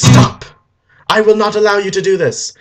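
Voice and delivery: loud stop, then high defiance; heroic command. Acted. Harry stopping the villain on the stairs, loud defiant command. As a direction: shout a halt, hammed-up hero.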